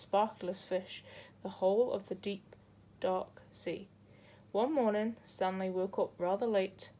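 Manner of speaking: ordinary delivery, nothing marked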